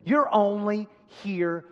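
emphatic, accusing put-down; scolding in forceful bursts with long pauses; speaking down. Acted. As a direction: talk down to an unwelcome younger brother. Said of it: "The distracting voice of defeat always speaks down to you".

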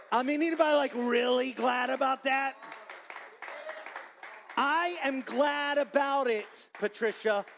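loud, high preaching call; rallying shout. Real, not acted. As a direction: fire up the congregation.

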